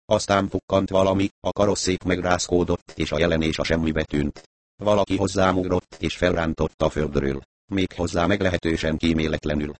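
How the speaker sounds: ordinary delivery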